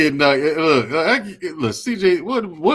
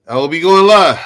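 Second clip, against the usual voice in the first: loud, punchy announcement of going live; announcing loudly; 3 semitones higher, 9 dB louder than usual. Shown as a demonstration. Hosts announcing his live show, loud. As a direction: belt it like an announcer. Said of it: "repeating his announcement, louder".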